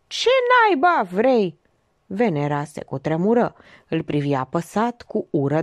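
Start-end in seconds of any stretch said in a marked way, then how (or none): 0.1-1.6 sudden irritated outburst, high and drawn out; izbucnire bruscă. A narrator's reading. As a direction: burst out in annoyance, pitch jumping up. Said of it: "Vocea lui Dan Manu izbucni dintr-o dată". (izbucnire iritată, dintr-o dată, cu glas ridicat)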